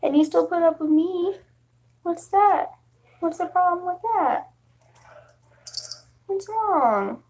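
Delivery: cooing at the cat. Real, not acted. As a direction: fuss tenderly over the cat. Baby talk to cat.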